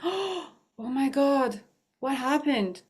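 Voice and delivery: as if it just dawned on you; shocked realization. Retold or imitated. Sudden realization about partner.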